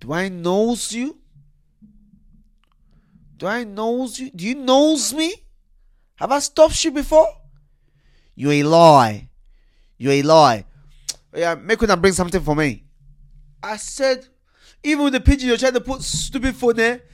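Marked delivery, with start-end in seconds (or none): 0.0-10.7 put-on high, loud Nigerian accent; Nigerian police officer. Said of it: "And he said". 11.3-12.8 lightly voiced Nigerian officer demanding; casual pidgin demand. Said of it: "he said".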